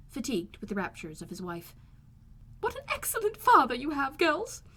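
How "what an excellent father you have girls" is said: high, loud, delighted gushing; gushing Mrs Bennet. A narrator's reading. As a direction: gush with rapture at the husband.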